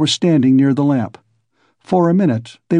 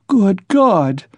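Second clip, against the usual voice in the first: higher-pitched exclamation of dismay; dismayed cry; 6 semitones higher than usual. A narrator's reading. Mother shocked by her son's farewell, crying out. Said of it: "cried Pulcheria Alexandrovna".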